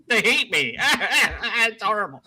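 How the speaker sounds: high-pitched joking lament with laughter; mock self-pity. Guest jokes that viewers don't want to know him, laughing mock self-pity. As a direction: laugh through a pretend complaint.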